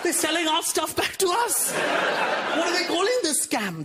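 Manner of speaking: high, shouted comic indignation; mock outrage. Indians discover their own tea sold back, mock outrage. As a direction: cry treachery, hammed-up disbelief.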